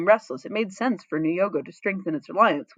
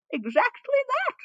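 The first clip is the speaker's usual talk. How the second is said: high, slow, drawn-out agreement; hint of a pleased old man; slower than usual. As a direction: savor the words like a wise elder.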